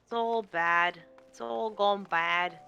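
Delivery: ordinary talk, nothing marked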